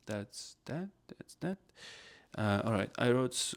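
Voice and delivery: ordinary talk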